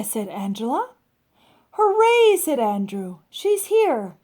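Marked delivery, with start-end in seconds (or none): 1.7-2.4 high, loud excited cheer. Acted. Child cheering as Amelia Bedelia arrives, loud and high. 3.3-4.2 high, louder gleeful announcement; excited kid. Acted. Child telling his sister Amelia arrived, high and excited.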